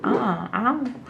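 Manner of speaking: ordinary delivery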